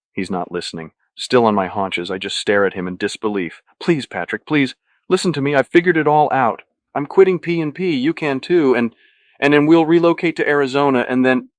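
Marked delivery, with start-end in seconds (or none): 3.8-11.5 high, loud, eager pleading; frantic pleading. A narrator's reading. Luis sells Patrick his escape plan, frantic plea. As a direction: plead loudly, pitched up, rushing to persuade.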